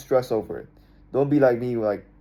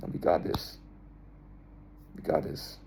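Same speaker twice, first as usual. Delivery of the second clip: whispered reassurance; 9 semitones lower, 12 dB quieter than usual. Retold or imitated. Calm self-reassurance.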